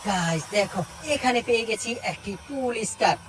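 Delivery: normal talking voice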